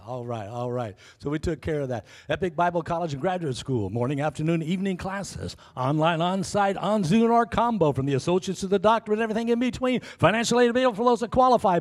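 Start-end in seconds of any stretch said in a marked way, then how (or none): 2.3-11.8 fast, rising announcer pitch; an upbeat college ad building up; ad read. Acted. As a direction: sell it like a quick promo. School advertisement read.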